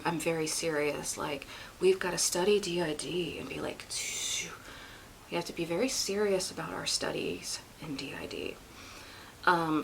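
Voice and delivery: hushed mock-serious Brandy; imitating Brandy. Parodying Brandy's video intro, hushed and mock-serious. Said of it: "Okay, Brandy".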